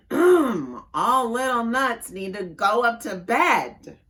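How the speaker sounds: mama getting firmer about bedtime; bigger mama voice. Acted. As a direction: lay down the law, slowly and loudly. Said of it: "in her bigger voice".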